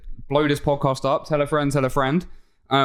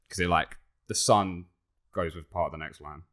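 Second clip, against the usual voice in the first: ordinary delivery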